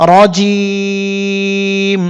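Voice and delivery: রজিম drawn out long, ending on a held মিম; pausal form. Shown as a demonstration. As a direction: stretch রজিম slowly, stop on the m. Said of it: "জিম শক্ত করতে হবে, র সোজা হবে". (রজিম ধীরে টেনে, মিম সাকিন করে)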